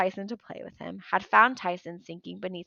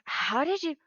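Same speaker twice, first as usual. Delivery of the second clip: gasped; 8 semitones higher than usual. Acted. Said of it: "Grover gasped".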